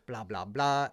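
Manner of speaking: new father imagining baseball, excitedly; excited dad-to-be. Retold or imitated. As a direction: gush about the baby boy. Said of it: "I was so excited".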